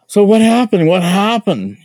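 urgent questioning. Retold or imitated. Urging the scared recruit.

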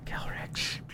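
a soft whispered name; whispering. Pretend. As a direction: whisper it to get attention. Calls friend in a whisper.

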